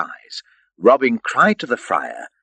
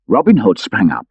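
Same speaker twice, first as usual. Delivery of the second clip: ordinary delivery, nothing marked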